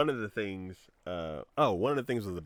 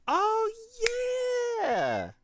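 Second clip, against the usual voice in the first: much higher, louder, thrilled; delighted; 8 dB louder than usual. Retold or imitated. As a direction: gush over the free treat. Delighted at a free pizza bowl.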